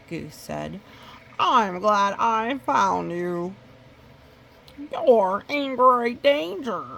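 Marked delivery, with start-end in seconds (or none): 1.4-6.8 louder, slow urgent alarm; urgent warning. Acted. Goose warning Mister Wolf of danger, loud and urgent. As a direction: sell the danger loudly.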